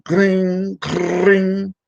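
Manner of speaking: high, ringing mimic of an old phone bell; phone ring imitation. Wrestler mimicking the phone ringing, high imitated ring. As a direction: chime it like a phone going off.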